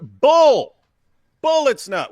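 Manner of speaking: loud, high outburst of disbelief; indignant shout. Real, not acted. Rejecting the apologists' excuse, a loud indignant shout. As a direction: blurt it out loud and angry.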